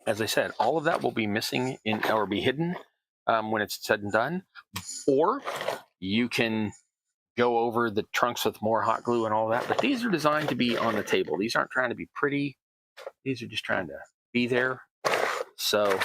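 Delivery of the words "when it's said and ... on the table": ordinary talk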